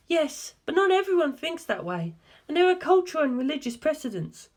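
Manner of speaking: a hint of a grave woman asking; earnest, probing question, lightly voiced; a faint woman's voice, asking seriously; lightly voiced Darlington. A narrator's reading.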